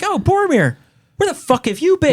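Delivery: high-pitched exasperated greeting; mock indignation. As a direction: demand it in a high, comic squawk of reproach. Companions confront returning Boromir.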